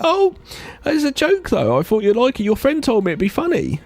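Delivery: very high, innocent put-on woman voice; hammed-up high breezy woman; falsetto woman voice. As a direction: coo it like it's a harmless joke. [name] explains the joke.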